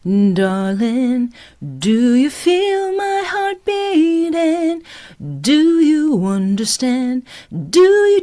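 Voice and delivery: sung high, slow, with crisp D's; bouncy D's. Shown as a demonstration. Coach singing the line with consistent D sounds. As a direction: hit every D consistently. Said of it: "here's what I mean".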